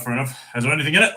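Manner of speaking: usual speaking voice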